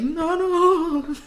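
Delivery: high, lightly put-on protest; mock protest. Reacting to the boys' excuse, high mock protest. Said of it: "And I'm just like".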